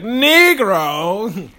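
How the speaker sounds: long, stretched-out loud call; drawn-out old-timey call. Retold or imitated. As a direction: draw the word out like an old-timey announcer. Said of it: "really long and drawn out".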